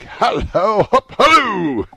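high, loud, long-drawn halloo; excited hallooing. A narrator's reading. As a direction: holler joyfully after someone running off. Calling out to Friday in delight.

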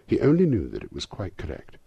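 ordinary delivery

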